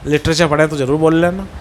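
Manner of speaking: ordinary talk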